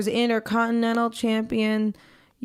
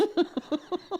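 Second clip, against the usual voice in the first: short, high, soft giggle; real quiet giggle; amused laugh; 7 semitones higher, 8 dB quieter than usual. Real, not acted. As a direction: giggle briefly under the breath. Laughs after quoting own refusal.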